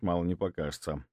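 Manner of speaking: usual speaking voice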